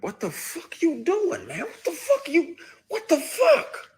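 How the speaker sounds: retold high-pitched yell building to a squeal; retold freak-out. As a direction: screech it like you're freaking out in the dark. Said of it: "And you spaz even more".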